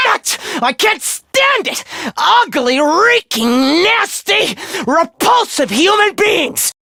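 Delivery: high-pitched screamed hatred; unhinged, shrieking rage; frenzied loathing. Acted. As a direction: scream out every word of disgust. Outburst of loathing for humans.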